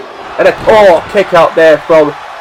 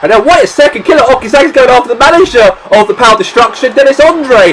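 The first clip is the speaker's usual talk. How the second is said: excited; 6 semitones higher than usual. Acted. Surprise attack on the manager.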